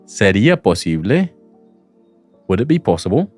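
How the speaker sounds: ordinary talk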